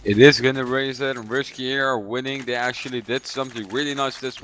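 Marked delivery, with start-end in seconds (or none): 0.0-1.1 loud echo. Real, not acted. Co-caster echoes the call.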